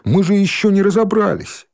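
high, urgent pleading; flustered protest. A narrator's reading. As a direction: fuss and try to stop the others. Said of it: "Димка засуетился".

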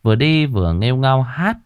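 ordinary delivery

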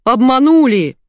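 outraged yell. A narrator's reading. Betrayed boss to his staff, outraged yell. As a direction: yell it slowly and indignantly. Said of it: "заорал Илья".

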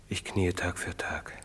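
ordinary delivery

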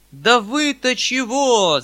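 high, very loud, drawn-out outburst; hot-headed shout. A narrator's reading. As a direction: shout at them in a temper. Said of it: "закричал я в запальчивости". (запальчивый протяжный крик)

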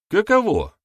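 high, loud outraged question; indignant exclamation. A narrator's reading. Elder ends his tirade about the witch, outraged cry. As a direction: throw it out in disbelief.

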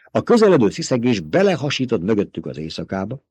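ordinary delivery, nothing marked

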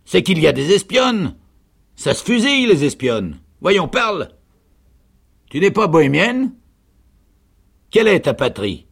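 loud, high, threatening interrogation; interrogating sergeant. A narrator's reading. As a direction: fire questions at full voice. (interrogatoire menaçant, crié par le sergent)

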